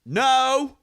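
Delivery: high, loud holler of protest; imitating an indignant heckler; heckler's shout. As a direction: shout it from the back of the room. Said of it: "I hear from the back of the room".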